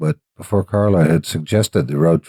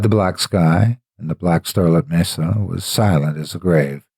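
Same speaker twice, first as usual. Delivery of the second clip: usual speaking voice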